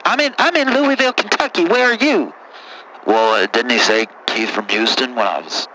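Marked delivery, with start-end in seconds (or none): 3.1-5.7 put-on much lower, rough-talking good ol' boy; deep caller voice. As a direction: play a low-voiced country caller. Same caller voice.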